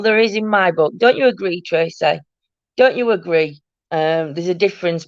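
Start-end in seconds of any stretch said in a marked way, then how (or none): none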